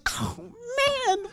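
high squealed mock-crying whine; squeaky, drawn-out cartoon lament; mock wail. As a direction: wail it way up high, comically dismayed. Acts out dismayed reaction to neighbor.

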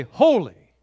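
loud, ringing cry of holy; loud angelic acclamation; proclaiming angels. Acted. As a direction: proclaim it like angels round the throne. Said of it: "they say".

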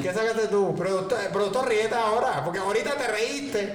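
jokingly calling out the producer. Real, not acted. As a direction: call the producer out, teasing. Teasing the producer who laughed.

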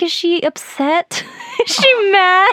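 high-pitched fretting with laughs breaking in; mock worry. Woman recalling her worry after the kiss, mock anxious.

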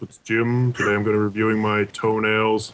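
low, droning monotone reviewer; Critical voice. Retold or imitated. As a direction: drone it out low and flat. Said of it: "That sounded more like critical".

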